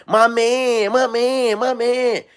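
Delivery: light mock of a doting girlfriend; girlfriend cheering. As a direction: coo it like a proud girlfriend. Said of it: "She used to be like".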